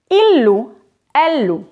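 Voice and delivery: ordinary talk, nothing marked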